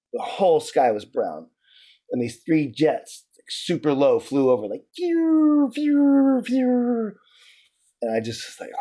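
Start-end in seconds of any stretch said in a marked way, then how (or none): 5.0-7.1 high whooshing "phew" for each passing jet; jet flyover noise. Retold or imitated. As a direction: imitate jets streaking over with long, high, held whooshes. Imitating jets flying low overhead.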